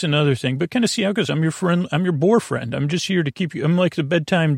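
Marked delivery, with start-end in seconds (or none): none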